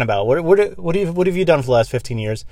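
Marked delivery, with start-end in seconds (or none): none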